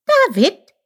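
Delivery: high, loud excited squeak. A narrator's reading. Chip guesses the forgotten king's name, excited squeak. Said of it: "sagte Chip aufgeregt".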